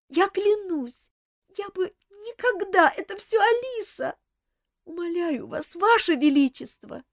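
high, loud terrified pleading; begging for mercy. Acted. Viscountess on her knees begging the king, terrified plea. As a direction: plead for your life on your knees. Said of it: "рухнула на колени".

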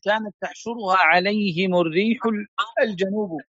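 scholarly read-aloud with fully voiced endings; formal text reading. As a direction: read the text aloud in careful classical Arabic. Reads a classical text aloud.

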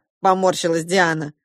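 usual speaking voice

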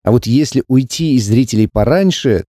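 ordinary delivery, nothing marked

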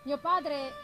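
usual speaking voice

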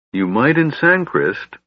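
dubious kender. A narrator's reading. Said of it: "Tass said dubiously".

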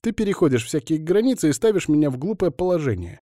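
high, fast, indignant female voice; squealing Alina. A narrator's reading. Alina protesting to Bogdan, squealing. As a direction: squeal it out in a rush. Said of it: "Пищала Алина знатно".

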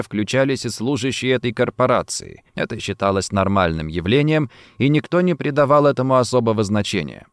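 plain reading voice

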